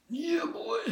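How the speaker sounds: falsetto. Pretend. Playful falsetto aside.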